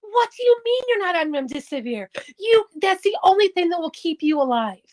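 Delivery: high-pitched outraged disbelief; emphatic mock yell. Doctor shocked at patient refusing remdesivir, emphatic retold yell. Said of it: "he very emphatically yelled".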